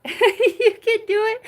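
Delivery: laughing through the words. Real, not acted. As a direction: say it while cracking up. Repeats line, now laughing.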